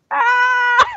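short high yelp of terror; high mock scream. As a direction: yelp like a scared kid. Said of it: "And he's just like".

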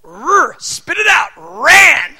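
put-on loud, high yelling at a child; hammed-up harsh correction; mock angry shout. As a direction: pretend furious parent barking. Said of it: "But I don't go".

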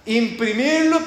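ordinary talk

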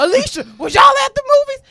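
high, loud accusing call; high, loud mock interrogation; accusing shout. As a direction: yell the question like a suspicious partner. Partner calling friend, acted.

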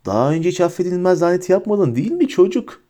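high-pitched, understated taunting shout. A narrator's reading. Bellatrix taunts Harry after his failed curse, mildly shouting. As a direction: call out a taunt without full volume. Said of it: "diye bağırdı Bellatrix".

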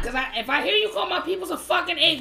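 usual speaking voice

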